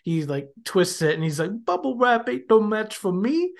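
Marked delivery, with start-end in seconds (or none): 1.7-3.5 high-pitched goofy tough-guy quote; playful put-on voice, comically cocky; silly mock bravado. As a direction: imitate a silly boast, pitched up, rising at the end. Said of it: "so stupid and so silly".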